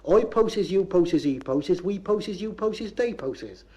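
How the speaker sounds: chanted Bristol dialect conjugation; Bristolese. Shown as a demonstration. Lecturer conjugates the verb to post, broad Bristolese recital. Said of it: "to get full marks in Bristolese".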